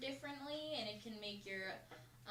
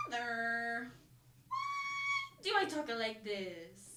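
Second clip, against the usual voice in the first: retold joke kid voice; mock kid's accent; 2 semitones higher, 6 dB louder, much slower than usual. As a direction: ham up the confused kid. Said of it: "I wanna give my kid an Italian accent".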